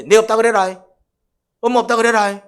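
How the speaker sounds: loud mock call to a child; calling out to hide. Hiding from an unwanted visitor, a loud mock call. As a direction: call it out so the visitor hears you're gone.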